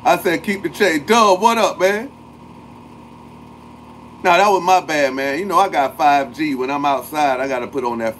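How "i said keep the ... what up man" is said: pitched-up, eager shout-outs; higher excited greeting. Real, not acted. Streamer re-greeting chat after connection loss, eager. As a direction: call the names out again, bright and eager.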